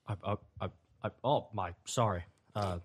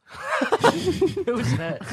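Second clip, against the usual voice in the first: laughing; much slower than usual. Real, not acted. Laughing at a friend's joke.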